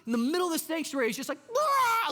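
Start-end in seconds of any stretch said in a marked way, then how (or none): 1.5-2.1 sudden high, loud "ah" scream; possessed scream. Acted. Preacher acting out a possessed man in church, screaming. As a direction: burst out in a mock demonic scream. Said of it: "starts screaming because he's possessed".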